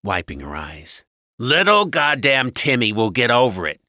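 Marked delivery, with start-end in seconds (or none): 1.4-3.8 loud, irritated husband, pitched up; all but yell it dismissively; raised, impatient husband voice; almost yelling. A narrator's reading. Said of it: "Max all but yelled".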